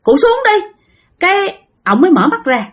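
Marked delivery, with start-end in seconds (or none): 0.0-0.7 pleading, rising higher and louder to a shrill top; father startled, begging the old man to climb down; startled plea. Retold or imitated. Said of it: "Thì ba mới nói rằng".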